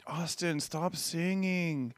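imitating a soft, drawn-out plea; weary parental plea. Husband voicing wife calling to son from bed, weary plea.

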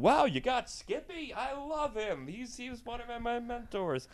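imitating a fawning fan; starstruck fan. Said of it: "you hear me going".

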